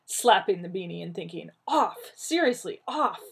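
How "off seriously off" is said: frantic. Acted. Slapping the helmet off.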